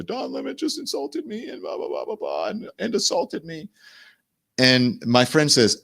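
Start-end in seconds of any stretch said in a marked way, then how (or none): 0.0-3.7 high, thin mock-hysterical whine; mock screaming. Guy complaining to the bartender, mock scream. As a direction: imitate screaming without volume, pitched way up. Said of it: "screaming at the bartender".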